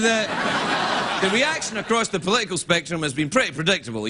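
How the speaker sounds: ordinary talk, nothing marked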